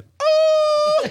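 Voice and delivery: high, loud squeal; mock scream. Retelling how his date screamed, a high loud squeal. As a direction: shriek it way up high. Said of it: "She started screaming".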